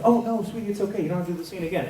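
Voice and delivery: high-pitched mock-sweet reassurance; imitated cooing comfort; reassuring colleague. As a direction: soothe them sweetly, pitch well up. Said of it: "Colin's like".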